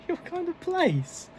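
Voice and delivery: incredulous. Real, not acted. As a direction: repeat it in total disbelief. Incredulous at the politician's remark.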